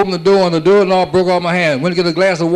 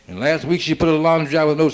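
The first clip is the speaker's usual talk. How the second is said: usual speaking voice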